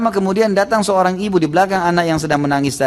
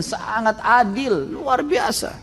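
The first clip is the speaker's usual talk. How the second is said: excited praise; 8 semitones higher, much slower than usual. Acted. Preacher praising the caliph's justice.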